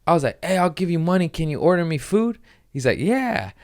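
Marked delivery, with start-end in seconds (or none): 0.4-2.4 high-pitched pleading across the lot; acted eager request. Retold plea to guy at window. 3.0-3.5 high-pitched yeah; acted eager agreement. Guy's reply, acted out.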